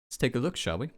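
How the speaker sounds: normal talking voice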